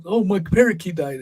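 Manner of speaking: high, hammed-up sheepish excuse; mock excuse. Host mocking the Twitter guy's excuse, high hammed-up sheepish voice. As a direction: fake a lame excuse in a silly high voice.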